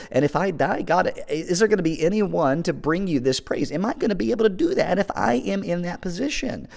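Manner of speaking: high, pleading questions, climbing in pitch; psalmist questioning God. Acted. Preacher voices the psalmist asking God about dying, pleading questions. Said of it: "questions are being brought to God because he feels like he is so low".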